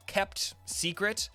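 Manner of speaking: normal talking voice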